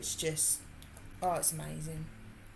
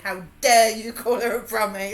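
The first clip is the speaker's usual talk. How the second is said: mock outrage; 3 semitones higher, 11 dB louder than usual. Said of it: "and he said".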